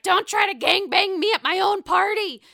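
high, loud mock quote; hammed-up indignant Karen. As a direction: mimic her indignant squawk. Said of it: "she's like".

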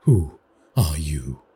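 slow fearful pleading question. A narrator's reading. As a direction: beg the unseen voice to answer. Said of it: "in a pleading tone".

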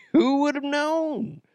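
sky-high fake wonder; mock surprise. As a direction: feign innocent amazement in a high voice. Mock shock after bursting bubbles.

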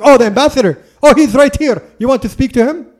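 imitated wife, high and loud, delighted recognition; sudden bright recognition. Wife on the phone realizing who is meant, high surprised mimicry.

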